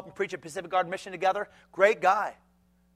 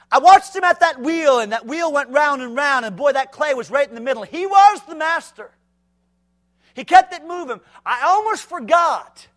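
higher, louder storytelling; animated preaching; 8 semitones higher, 10 dB louder than usual. Acted. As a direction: paint the potter scene with fire. Vivid telling of the potter.

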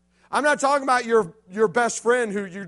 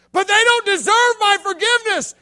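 higher and louder, complaining; whiny listener; 11 semitones higher, 10 dB louder than usual. Retold or imitated. A listener objecting to the preacher, whiny and high. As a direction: protest like a wronged teenager. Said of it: "listener's objection".

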